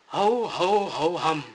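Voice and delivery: loud blustering ho ho hum; Papa Bear grumbling at his messed chair; Papa Bear harrumph. Acted. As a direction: bluster like a grumpy big bear.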